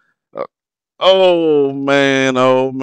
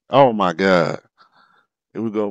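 usual speaking voice

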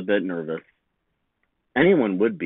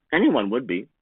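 ordinary delivery, nothing marked

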